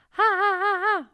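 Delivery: high, loud ha, each cut by closing cords; teacher adds vocal-cord closing to the high ha; high ha with glottal closing. Shown as a demonstration. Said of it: "The closing".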